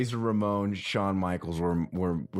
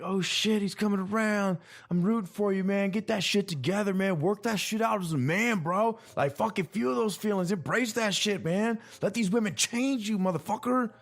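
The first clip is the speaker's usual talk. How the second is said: high, emotional bro voice; 11 semitones higher than usual. Retold or imitated. Cheering on Sam Sylvia while watching, in a high bro voice. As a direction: gush like a moved bro rooting for him. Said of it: "I know I'm using that voice".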